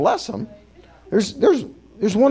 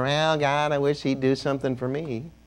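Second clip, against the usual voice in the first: grumbling envy; 5 semitones lower, 6 dB quieter than usual. Retold or imitated. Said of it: "Not too many rejoicers in here".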